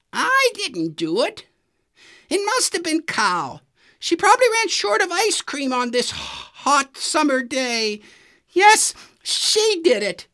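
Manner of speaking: very high, nervous fibbing voice; Donkey lying. A narrator's reading. Donkey blaming Cow to his friends, nervous lie. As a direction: lie and hope they buy it.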